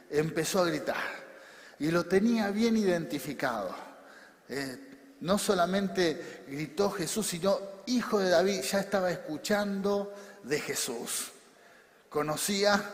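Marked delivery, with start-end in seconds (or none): none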